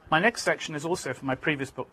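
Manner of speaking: usual speaking voice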